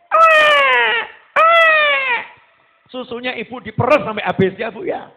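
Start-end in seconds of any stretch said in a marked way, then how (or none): 0.1-2.2 very high, loud imitated baby wail; wailing baby cry. Preacher imitating a baby crying while nursing, high wail. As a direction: shriek like a crying infant.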